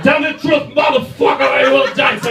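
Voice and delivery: high, loud mock-tough yell; shouted threat. Comic foreigner yelling at the corner shop clerk, high and loud. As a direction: bark it at the shopkeeper like a gangster. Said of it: "I said".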